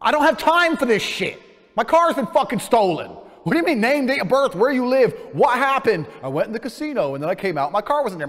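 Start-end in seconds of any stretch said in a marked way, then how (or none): 0.1-6.1 higher, louder furious rant, reliving it; retold angry outburst. Retelling the car theft to the officer, high and loud in fury. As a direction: vent at her like it's happening again.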